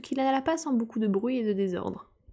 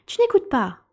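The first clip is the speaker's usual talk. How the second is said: higher, louder scolding; stern reproach; 5 semitones higher, 6 dB louder than usual. A narrator's reading. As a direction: tell off an inattentive listener sharply. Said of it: "reprocha Alice la souris d'un ton sévère". (reproche sévère, voix montée)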